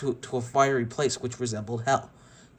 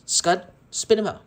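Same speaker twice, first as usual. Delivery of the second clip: bossy command; 7 semitones higher, 6 dB louder than usual. Acted. Sid ordering his dog.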